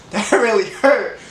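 slow, laughing through the words; chuckling at his own mishap. Real, not acted. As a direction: repeat it while cracking up. Laughing while recalling pain.

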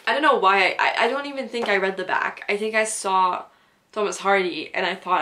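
usual speaking voice

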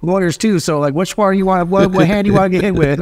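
mock tough threat. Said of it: "I'm like".

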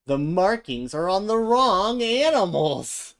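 loud, slow, high gasp of alarm; big storyteller shock at the mix-up; shocked exclaim. A narrator's reading. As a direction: announce the mix-up like a disaster. Narrator exclaims at mixed-up animals.

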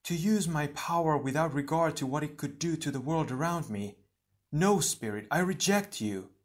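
indignant heroine standing firm; defiant rejection. Acted.

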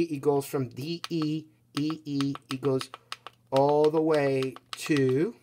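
normal talking voice

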